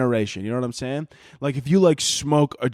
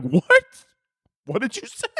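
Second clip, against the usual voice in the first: stunned disbelief; 8 semitones higher than usual. Acted. Caller hearing an insult answers in high stunned disbelief. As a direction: caught off guard, can't believe the ears.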